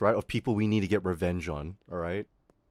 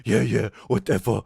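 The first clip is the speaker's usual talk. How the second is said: imitating a dismissive schoolboy, a bit louder; mocking taunt; 4 dB louder than usual. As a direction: put on his taunting voice, lightly. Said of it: "he was saying things like".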